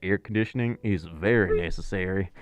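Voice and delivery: low-pitched, hammed-up Texan voice; best Texan accent. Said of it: "I put on my best Texan accent".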